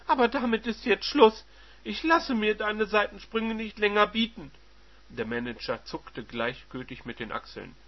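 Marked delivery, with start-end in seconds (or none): 0.1-4.5 loud, high and furious; angry wife. Acted. Wife confronting her cheating husband, loud and angry. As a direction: slam the door on his affairs. Wife ends the marriage quarrel.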